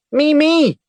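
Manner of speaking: very high, loud short bursts; shrill as a ship's whistle; high-pitched shouts. A narrator's reading. Little girl shouting to be chosen, high and loud. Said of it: "she repeated".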